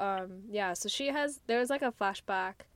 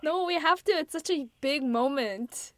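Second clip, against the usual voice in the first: louder, higher, insistent protest; emphatic insistence; 5 semitones higher, 6 dB louder than usual. Real, not acted. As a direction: push back hard on the plea. Insists after friend's plea to stop.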